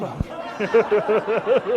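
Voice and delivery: a big, real laugh; laughing out loud; real belly laugh at the memory; loud burst of laughter. Real, not acted. Remembering Zig's greeting.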